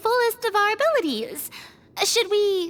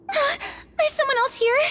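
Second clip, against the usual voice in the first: gasping, higher and slow; startled gasp; 10 semitones higher than usual. Acted. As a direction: catch your breath in alarm. Startled in the dark.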